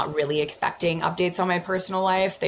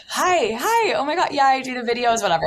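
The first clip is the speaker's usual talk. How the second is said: much higher, louder, giddy hi's; flustered greeting; 10 semitones higher, 5 dB louder than usual. Retold or imitated. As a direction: gush back awkwardly at a fan. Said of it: "I'm like".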